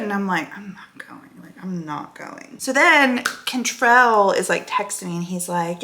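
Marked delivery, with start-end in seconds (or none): 0.6-2.6 whispered, much softer refusal; inner voice. Retold or imitated. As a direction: whisper it like a thought to yourself. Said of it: "in my head, I'm like".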